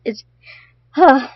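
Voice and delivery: usual speaking voice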